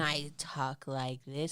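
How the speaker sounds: low, slow deadpan; straight affect. Shown as a demonstration. Showing their voice once they blame the partner, straight affect. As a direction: drop into an emotionless low voice. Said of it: "I just get this straight affect".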